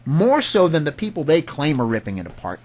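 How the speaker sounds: normal talking voice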